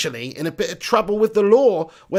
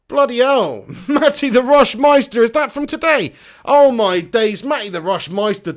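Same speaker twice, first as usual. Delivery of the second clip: loud, high burst of real surprise; amazed; 5 semitones higher, 7 dB louder than usual. Real, not acted. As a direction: blurt it out, stunned by a donation. Surprised by a viewer's donation.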